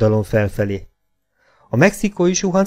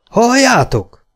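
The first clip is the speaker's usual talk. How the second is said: loud, high-pitched yell; 13 semitones higher, 7 dB louder, slower than usual. A narrator's reading. Robber alerting the gang to gunfire, yelled. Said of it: "ordította Gunner".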